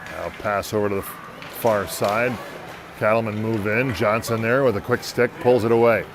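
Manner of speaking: brisk hockey announcer calling the action; live play-by-play. Acted. Announcer calling the hockey game for listeners, in brisk play-by-play. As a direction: call the game as it happens, rising on big plays.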